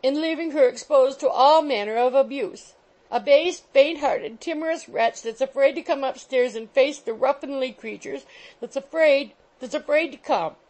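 high, loud shrill taunt. A narrator's reading. Landlady taunting her husband to come upstairs. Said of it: "repetition of the taunt".

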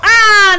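high, loud ah of frustration; loud frustrated cry. Real, not acted. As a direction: shout out the miss. Frustrated at missed ability.

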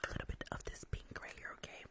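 breathy whispered roleplay throughout. Acted. Nail tech gossiping on the phone at work, whispered.

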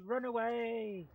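slowed, comic repeat of the alarm; drawn-out cry. Real, not acted. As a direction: stretch the words as you flee. Fleeing danger in game.

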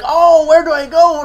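hammed-up clueless heroine asking for directions; mock helpless heroine. Mocking the Star Wars heroine with the toy wayfinder, whiny parody.

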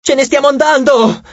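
high, urgent call; yelling. A narrator's reading. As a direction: call out over the battle that time is up. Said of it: "urlò Nico".

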